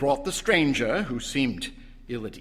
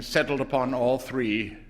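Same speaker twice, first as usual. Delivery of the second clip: plain reading voice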